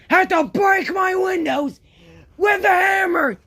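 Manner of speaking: ranting yell about broken windows; angry shout. Acted. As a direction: blast the accusation at him. Angry rant at the other.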